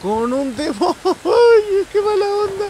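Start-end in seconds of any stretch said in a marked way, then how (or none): 1.0-1.9 sudden high burst of laughter. Real, not acted. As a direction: crack up loud. Ríe por la escena. (carcajada aguda)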